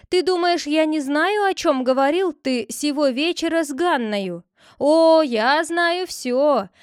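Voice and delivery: angry scolding. Acted. The sister-in-law scolds the head for his schemes, angry. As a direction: berate him, threatening. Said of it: "вскричала она".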